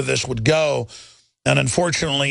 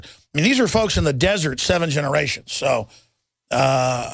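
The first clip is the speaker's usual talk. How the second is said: usual speaking voice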